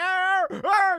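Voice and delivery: loud, high wordless party yells; mock party screaming. Mimicking his friend in the club, mock screaming. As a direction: holler like an overexcited clubber. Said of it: "He's screaming".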